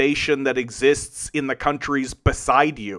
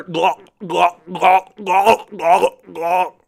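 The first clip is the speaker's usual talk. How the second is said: repeated mocking "glok" gulps, higher and louder; mock gulping noise; 4 semitones higher, 5 dB louder, much slower than usual. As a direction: gulp it out to ridicule the viewer. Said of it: "you're just sitting there".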